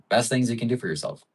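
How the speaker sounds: ordinary talk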